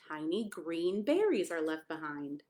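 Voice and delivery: usual speaking voice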